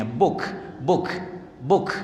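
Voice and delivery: book repeated with a crisp k at the end; final kaf in book. Shown as a demonstration. Teacher drills book for the kaf ending, clearly articulated k. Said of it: "kaf itu ujungnya".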